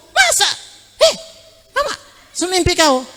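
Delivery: high, squealing disbelief; shocked wife. Retold or imitated. Wife hearing a stranger claim to be her husband, high shocked squeal. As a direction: shriek it like she can't believe it.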